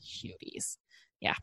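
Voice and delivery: whispered. Real, not acted. Tender aside about kids.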